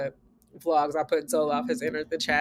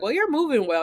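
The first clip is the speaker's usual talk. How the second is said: mimicked doubting staff; 8 semitones higher than usual. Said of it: "and they'd be like".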